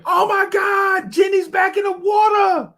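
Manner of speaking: high mock-panicked friend's scream. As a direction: scream for help from the shore. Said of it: "her friend starts screaming".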